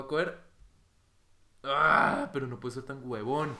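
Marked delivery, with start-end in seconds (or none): 1.6-2.3 loud, high cry of frustration; frustrated yell. Real, not acted. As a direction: cry out at your own mistake. Blunders in the game. (grito de frustración)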